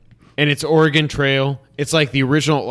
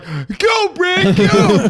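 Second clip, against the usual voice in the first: high, excited cheer; 3 dB louder, much slower than usual. Retold or imitated. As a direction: cheer him on loudly. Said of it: "and Sean's like".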